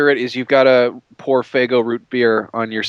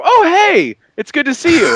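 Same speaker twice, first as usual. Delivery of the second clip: high, loud mock-cheerful greeting; overdone chirpy hello; cheery greeting; 5 dB louder than usual. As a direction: greet them far too brightly, pitched way up. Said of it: "you get up and you go".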